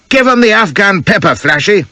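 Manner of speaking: high rowdy call from the crowd; spectator's cry. A narrator's reading. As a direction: egg the bowler on from the ropes. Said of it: "cries one".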